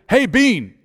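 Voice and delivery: high-pitched call to get attention. Retold or imitated. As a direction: call across the gym to him. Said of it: "I yelled at him again".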